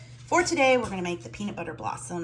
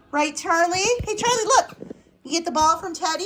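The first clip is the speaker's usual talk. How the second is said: pet talk; 9 semitones higher, 6 dB louder than usual. Real, not acted. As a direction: giggle and coo at the dog. Talking to Charlie about ball.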